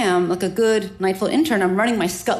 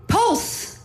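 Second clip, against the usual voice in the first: much higher, sharp eureka on "pulse"; sudden realization; 12 semitones higher than usual. Retold or imitated. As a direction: pop "pulse" up high as the idea hits. Sudden idea in a panic.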